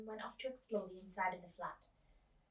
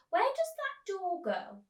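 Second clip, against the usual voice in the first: higher, slow wondering question; a small girl asking her mother about the door, high and slow; curious child; 10 semitones higher, 10 dB louder, slower than usual. Acted. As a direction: ask it slowly, high and puzzled.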